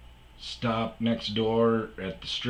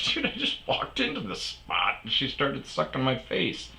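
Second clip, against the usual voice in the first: mock protest; 6 semitones higher than usual. Retold protest to the manager.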